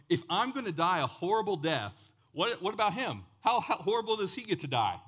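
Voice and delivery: loud, high-pitched mock outrage; indignant protest. Peter objects to dying a horrible death, indignant. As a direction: complain loudly that it's unfair.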